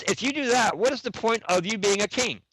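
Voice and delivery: indignant challenge. Retold or imitated. Partner challenges the speaker over delegating, retold indignantly.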